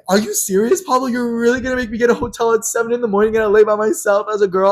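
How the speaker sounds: high, loud incredulous protest; outraged friend. Retold or imitated. Friend protesting being sent to a hotel, outraged. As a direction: imitate an indignant friend in one rushing complaint.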